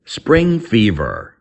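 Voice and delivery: slow, careful teacher's model of the phrase; slow clear model. Shown as a demonstration. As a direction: say it slowly for learners to repeat. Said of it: "Common English expression".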